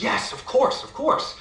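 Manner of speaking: put-on high, panicked girl's agreement; terrified. A narrator's reading. Terrified girl agreeing to the imp's bargain, frightened and high. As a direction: gasp out a desperate high-pitched yes. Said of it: "she's so terrified that she says".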